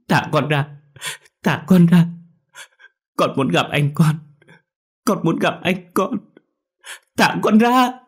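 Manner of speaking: high, loud tearful pleading, building up; a boy fighting to break free, sobbing; crying struggle. A narrator's reading. Boy struggles in aunt's arms, crying loudly. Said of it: "Quang lại khóc lớn hơn, nó cố vùng vẫy".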